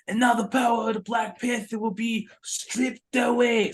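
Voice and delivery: high, strained proclamation in character; Forrest Whitaker. Retold or imitated. Film fan mocking the line that broke immersion, high theatrical impression. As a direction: declaim it like a grave movie elder. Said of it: "until I hear".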